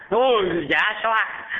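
mock-horrified cry, high and drawn out; imitated panic. Villagers recoiling from his face, mock panic. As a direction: cry out slowly like a startled crowd. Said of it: "mọi người hốt hoảng".

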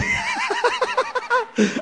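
a high hearty burst of laughter. Real, not acted. Monk laughing at the smiling Buddha, hearty laugh.

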